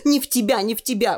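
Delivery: put-on soothing girl. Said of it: "успокоила Таня".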